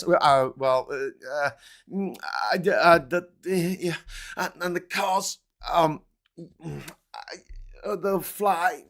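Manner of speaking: halting, stammering mumble; mumbling Musk impression. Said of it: "talks like this".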